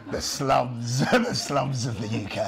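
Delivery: slow hushed documentary narration, breaking into laughs; a laughing hint of a wildlife presenter; David Attenborough voice. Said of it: "my lost voice is good for this".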